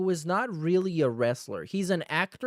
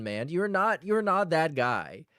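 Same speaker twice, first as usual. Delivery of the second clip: usual speaking voice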